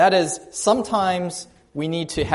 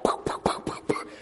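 breathy poke poke, lightly voiced; soft mocking poke sounds; mock poke noises; 8 dB quieter than usual. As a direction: tap out the pokes under your breath. Students poking paralyzed patient.

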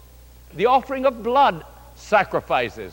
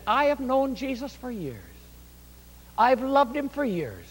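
high, softened grandmotherly protest; pitched-up, gently indignant testimony; retold pious protest; 6 semitones higher, 5 dB quieter than usual. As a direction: imitate an earnest old believer defending herself. Grandmother protests her long faith.

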